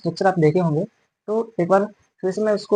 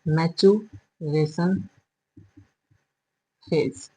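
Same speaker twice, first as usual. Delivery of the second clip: slow dictation; 5 dB quieter, much slower than usual. Shown as a demonstration. Teacher spells term while writing.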